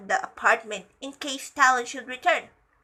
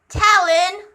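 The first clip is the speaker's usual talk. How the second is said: high, loud urgent call; calling out after him; 7 semitones higher, 8 dB louder than usual. A narrator's reading. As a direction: shout the name after someone leaving. Penny calls out after Talon.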